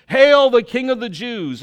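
loud, high, jeering salute; mock him as a false king; mocking hail. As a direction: soldiers taunting Jesus, loud and mocking. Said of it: "And they came up to him, saying".

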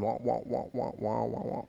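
muted trombone wah wah; soft droning wah wah; Charlie Brown teacher. Retold or imitated. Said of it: "the teacher from Charlie Brown".

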